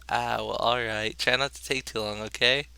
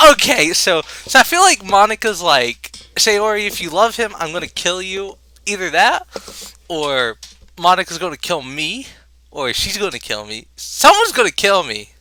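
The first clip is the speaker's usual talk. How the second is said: high, loud, animated; excited rant; 7 semitones higher, 9 dB louder than usual. Real, not acted. Reacting to the poem, he rants about Monika, excited and loud. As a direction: rattle it off all worked up.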